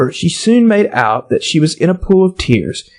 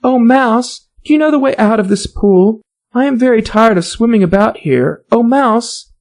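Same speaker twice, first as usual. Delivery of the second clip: put-on higher, politely solemn girl; formal address to a mouse; 7 semitones higher than usual. A narrator's reading. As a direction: speak grandly to a mouse. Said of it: "the right way of speaking to a mouse".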